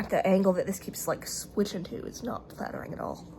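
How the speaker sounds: whispered under breath; quiet self-conscious aside; muttered aside. Real, not acted. Muttered aside about camera angle.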